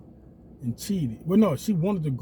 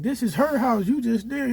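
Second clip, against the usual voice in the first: mock neighbors; 8 semitones higher, 4 dB louder than usual. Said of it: "They like".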